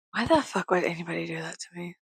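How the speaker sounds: fast hushed mock grievance; whispered complaint. Real, not acted. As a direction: whisper it like a wounded aside.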